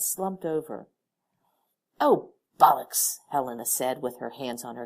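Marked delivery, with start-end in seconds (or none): 2.0-3.2 loud, slow annoyed curse; exasperated curse. Acted. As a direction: curse at the mess.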